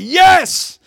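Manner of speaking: high, excited yelp of joy; excited cry. Retold or imitated. Jesus rejoicing greatly with the Father, excited cry. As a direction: jump in with a high, excited yes. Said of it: "It was kinda like".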